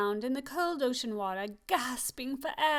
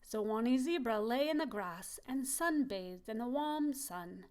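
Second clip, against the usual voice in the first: plain reading voice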